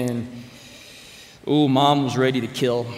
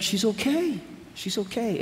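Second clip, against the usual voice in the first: put-on child's voice; 9 semitones higher, 3 dB quieter than usual. Voicing four-year-old son.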